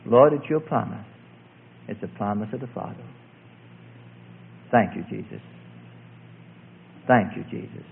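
soft, low murmured prayer; imitating a whisper. Preacher prompting a praying girl, hushed half-whisper. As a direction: pray low and close into an ear. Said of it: "I began to whisper in her ear".

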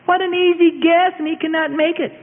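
very high mocking voice; jeering. A narrator's reading. Mob taunting the powerless prisoner, jeering. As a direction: scoff in a high, put-on voice.

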